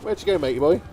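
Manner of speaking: loud searching call, tailing off. Retold or imitated. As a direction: call out for a lost companion. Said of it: "I was like".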